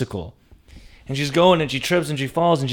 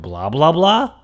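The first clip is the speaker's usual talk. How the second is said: last BLAH swoops up high; tentative name-call, ending high on "blah"; questioning call; 5 semitones higher, 3 dB louder, slower than usual. Retold or imitated. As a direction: call up with a steep rise on the final "blah". Calling up to her on balcony.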